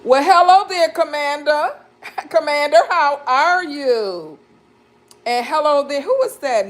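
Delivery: high, bright hello to a newcomer; light up as you welcome them; excited greeting. Real, not acted. Host greeting Commander joining the live stream, excitedly high. Greeting a viewer who joined.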